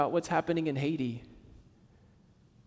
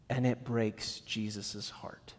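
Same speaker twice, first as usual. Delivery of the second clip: hushed grief; 8 semitones lower, 9 dB quieter, slower than usual. Real, not acted. Preacher describing Jesus' grief.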